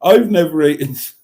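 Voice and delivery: breaking into giggles mid-sentence; can't get the words out for laughing. Real, not acted. Reading the comment aloud, laughing.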